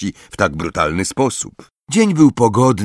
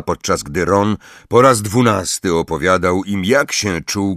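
ordinary talk

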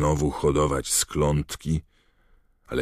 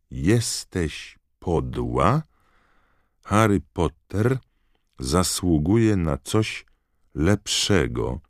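very slow, word-by-word accusation; halting, emphatic reading with long drawn words; hate letter; 4 semitones higher, much slower than usual. Acted. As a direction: spell out each phrase of the nasty letter.

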